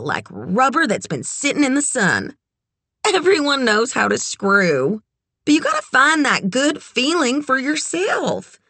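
high, rolling, confident advice; brassy, worldly friend. A narrator's reading. Red lectures Kit from experience, brassy and relishing. Red's character voice, advising Kit.

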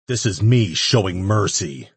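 grim growl before striking. A narrator's reading. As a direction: growl it as a final threat. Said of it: "Ash growled".

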